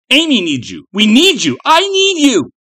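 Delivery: put-on urgent, escalating child's appeal; desperate pleading. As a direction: beg her, building to a high cry. Child pleading with unresponsive mother.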